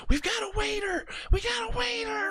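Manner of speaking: high-pitched mock scream of an owner in a flap; retold panicked screaming. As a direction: imitate a frantic boss screeching the alarm. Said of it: "comes out screaming, shaking a work order".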